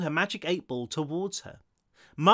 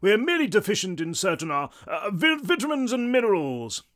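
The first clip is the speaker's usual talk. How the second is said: high, haughty English explanation; scoffing English ghost; 6 semitones higher, 3 dB louder than usual. A narrator's reading. Tall ghost excusing his state, scoffing English. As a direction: explain loftily in a grand English voice. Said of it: "scoffed the tall ghost".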